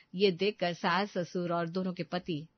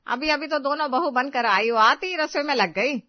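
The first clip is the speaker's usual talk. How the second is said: loud, raised call to the daughters-in-law; fussing mother-in-law calling across the house; 5 semitones higher, 6 dB louder than usual. Acted. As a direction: call out in mild, lively protest.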